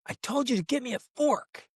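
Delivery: put-on high, bossy child voice; mock-bratty nine-year-old; sassy little girl. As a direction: demand it like a spoiled kid. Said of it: "this little sassy attitude".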